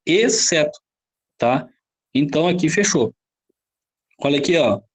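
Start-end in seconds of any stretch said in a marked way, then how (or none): none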